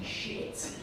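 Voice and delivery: whispered curse to yourself; under-the-breath dread; panicked inner thought. Retold or imitated. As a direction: drop to a voiceless, hushed aside. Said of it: "and I'm thinking".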